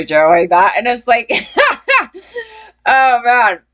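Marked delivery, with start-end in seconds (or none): 1.3-2.4 high, short burst of mocking laughter; derisive laugh. Real, not acted. Laughing at guys who fake their voices, derisive high laugh.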